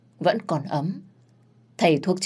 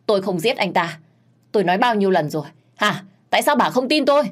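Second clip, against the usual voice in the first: higher, louder frightened retort; defiant shouting back; 4 semitones higher, 4 dB louder, faster than usual. Acted. Maid shouts back at the accusing mother, defiant retort. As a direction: strain your neck and argue back. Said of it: "gân cổ và cãi lại".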